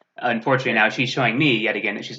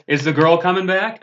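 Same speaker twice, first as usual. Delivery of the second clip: clueless suspect; 6 semitones higher than usual. Retold or imitated. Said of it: "the other one I love too is".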